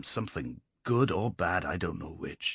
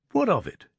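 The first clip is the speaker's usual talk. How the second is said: proud, dismissive question pitched much higher; cold, proud; 11 semitones higher than usual. A narrator's reading. Lad brushing off the weight of rock, cold and proud. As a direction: toss it off coldly, above them. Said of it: "he said in a cold, proud voice".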